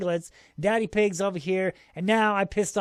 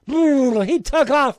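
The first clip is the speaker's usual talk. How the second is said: excited; 7 semitones higher, 10 dB louder, slower than usual. Retold or imitated. Pig bolts suddenly.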